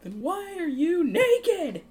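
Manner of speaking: high, loud, incredulous demand; incredulous shout. Acted. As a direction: shout it in disbelief at the culprit. Kilowog confronting Hal.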